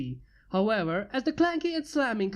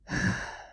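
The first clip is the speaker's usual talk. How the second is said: sigh. A narrator's reading. Bored human sighs.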